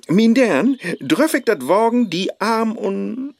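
"Dern" belted loud and high, rising; overdone flirting. Acted. Faust tries a pushy pickup line, too loud and high. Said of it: "nee, dat klingt verlogen".

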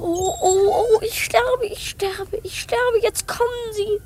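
high, near-crying fright; panicked wailing. Acted. Hidden boy fears the gunmen will find him, high and whimpering. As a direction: whimper in dread.

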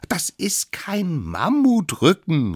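high, slow, insistent denial; calling from above. A narrator's reading. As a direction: call it down firmly, drawn out. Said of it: "rief es von oben herab". (hoch und bestimmt herabgerufen)

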